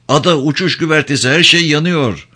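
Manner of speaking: high, fast urgent call; urgent shout. Acted. Crow telling Leon the deck is burning, high fast shout. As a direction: rattle it off in panic.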